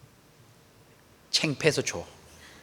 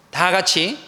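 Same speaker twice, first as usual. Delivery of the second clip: ordinary talk, nothing marked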